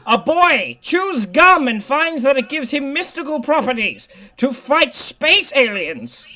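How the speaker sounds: high, slow dramatic read. Acted. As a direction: present it like a quiz host. Reads quiz prompt dramatically.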